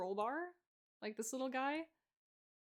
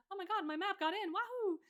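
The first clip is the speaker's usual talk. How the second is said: excited squeal; 9 semitones higher, 4 dB louder than usual. Retold or imitated. And I'm like.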